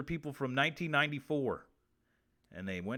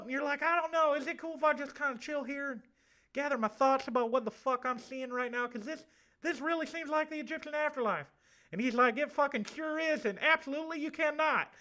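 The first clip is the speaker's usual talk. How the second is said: effeminate southern man with a lisp; 10 semitones higher, 5 dB louder than usual. Acted. Spirit contacted from the Egyptian afterlife, high lisping southern voice. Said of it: "it's me, the effeminate southern man with a lisp".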